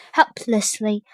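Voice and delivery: plain reading voice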